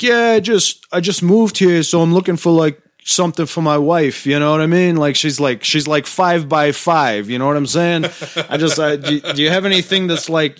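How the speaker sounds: high, loud Brooklyn Italian wiseguy voice; Brooklyn Italian prank caller. Retold or imitated. Prank-calling a lingerie store, loud high Brooklyn Italian voice. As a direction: ham up a Brooklyn Italian roofer on the phone. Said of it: "my Dom Izzo character".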